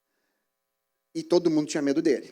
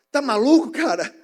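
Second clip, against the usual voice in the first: higher, louder scoffing retort; incredulous retort; 7 semitones higher, 8 dB louder than usual. Retold or imitated. Coworker scoffing at the preacher, loud and incredulous. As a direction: brush him off in disbelief. Coworker rebuffs his witness.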